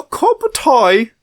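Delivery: a hammed-up British accent on a cup of tea; bad British accent. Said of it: "I can't do a good British accent".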